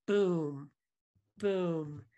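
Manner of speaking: slow, each word dropped heavily; one word at a time. Shown as a demonstration. Reading a verse over a friend, slow and heavy. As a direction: let every word land like a weight. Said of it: "one word at a time".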